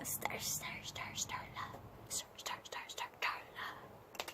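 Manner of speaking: hushed, repeated whispered call; whispering. Acted. Teacher checking back on Starla, whispered calling. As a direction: whisper the name over and over.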